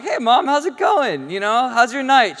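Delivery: high, loud, over-casual phone hello; breezy casual greeting. Retold or imitated. Casual greeting hiding a serious injury.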